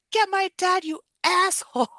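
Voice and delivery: very high, mock-furious cry; hammed-up angry shriek; imitated pissed-off yell. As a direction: act out screaming at a useless helper. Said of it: "She's so pissed".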